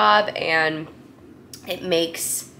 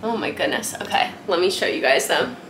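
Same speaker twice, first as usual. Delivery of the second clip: ordinary delivery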